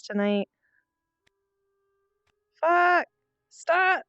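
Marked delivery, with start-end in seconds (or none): 2.6-4.0 sudden high angry yell; raging gamer. Real, not acted. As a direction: snap at the screen, loud. Lag spike in game.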